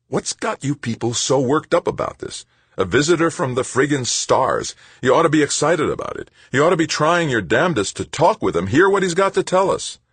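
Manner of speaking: high, loud indignant outburst, rising; heated protest. A narrator's reading. Tavalera angrily berating Mayfair about the visitor. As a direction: argue it out in exasperation. Tavalera argues, growing angry.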